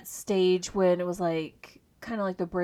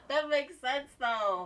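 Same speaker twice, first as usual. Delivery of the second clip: laughing; 6 semitones higher, 7 dB quieter, slower than usual. Real, not acted. Laughs at story.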